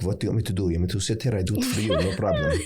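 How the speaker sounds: put-on Middle Eastern accent. Shown as a demonstration. Actor showing hosts an audition accent, Middle Eastern accent. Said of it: "learned a Middle Eastern act-, accent, like".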